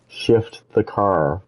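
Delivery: slow, stretched shift the car; slow emphasis. Real, not acted. Vlogger showing his standard shifter, slow and stressed. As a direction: lean on it slowly. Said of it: "I have to actually s".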